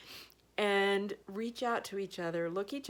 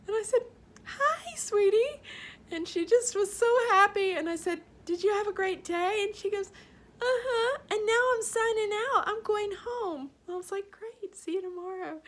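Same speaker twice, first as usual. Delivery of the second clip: tearful; 13 semitones higher, 5 dB louder than usual. Real, not acted. Teacher retelling the happy meeting, tearful and choked up. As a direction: voice high and breaking with real tears. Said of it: "I've cried about it three times already".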